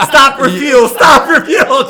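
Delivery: loud, high laughing chant; gleeful shout. Pretend. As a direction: shout it giddily like a getaway. Joking fugitive airplane fantasy.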